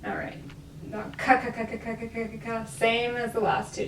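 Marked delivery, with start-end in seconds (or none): 1.2-2.6 rapid ka ka ka repeats; plosive drill. Shown as a demonstration. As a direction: fire off ka as fast as possible. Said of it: "just as fast as you can".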